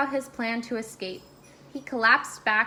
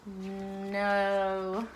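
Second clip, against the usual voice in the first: usual speaking voice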